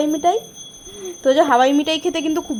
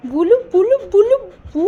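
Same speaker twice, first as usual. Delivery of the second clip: high cooing; 6 semitones higher than usual. Real, not acted. Cooing at the cat.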